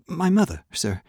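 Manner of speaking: voice shaking slightly. Acted. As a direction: nervously plead his case. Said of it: "The boy's voice shook slightly".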